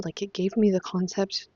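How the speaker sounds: ordinary delivery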